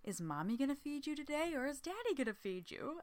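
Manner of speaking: high, soft cooing mom voice; mock baby talk. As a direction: coo at the baby in a mocking sing-song. [name] mocking cooing to baby.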